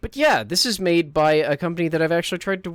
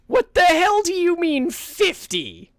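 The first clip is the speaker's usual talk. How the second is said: much higher, louder, slow incredulous yell; outraged shout; 7 dB louder, slower than usual. Shown as a demonstration. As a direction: scream it in disbelief.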